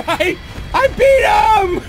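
high, loud, slow triumphant yell; victory scream. Real, not acted. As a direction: shout it out in real joy. Finally beats the boss.

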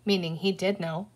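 ordinary delivery, nothing marked

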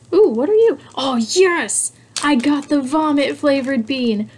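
overjoyed unboxing squeal; real excitement. Real, not acted. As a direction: burst out thrilled at the find. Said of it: "You can hear my excitement".

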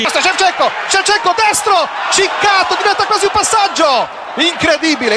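ramp up as the shot goes wide; excited play-by-play. Real, not acted.

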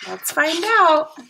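plain reading voice

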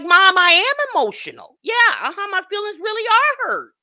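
high-pitched mock protest of an upset kid; imitated pleading child. Vlogger voicing an emotional son to his mom, pleading.

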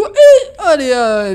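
loud mocking outburst, high; mock yell. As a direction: shout it in mock impatience. Mocking the character urging him.

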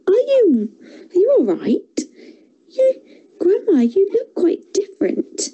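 put-on high, hushed child's voice; worried young girl; little girl voice. A narrator's reading.

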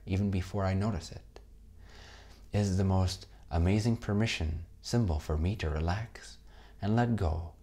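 calm meditation voice with long pauses; guided meditation. Acted. Teacher closing the course for students, guided meditation. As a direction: lead them gently into rest, leaving space between phrases.

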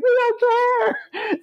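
high and slow, sing-song; defiant taunt. Retold or imitated. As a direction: stretch it out playfully. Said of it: "we're like".

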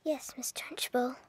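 tiny whispered reply; cowed pupil whispering; meek whisper. Acted. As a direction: barely breathe the answer. Pupil answers meekly.

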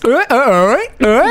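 slow, goofy cartoon drawl; cartoon character impression. Player showing how the movie's cartoon talks, slow goofy imitation. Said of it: "He talks like this".

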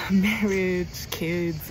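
normal talking voice

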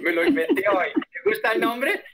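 más agudo, divertido; riendo. Real, not acted. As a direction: bromeando entre risas. Profesor bromea sobre el nombre. (riéndose del nombre inventado)

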